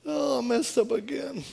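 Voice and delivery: lightly whiny, high-pitched confession; a hint of a pleading repeat offender; mock contrite whine. Husband confessing to his pastor again, mock contrite whine. Imitates the contrite man coming back.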